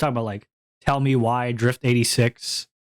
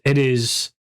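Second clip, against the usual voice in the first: ordinary talk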